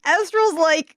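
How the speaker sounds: ordinary talk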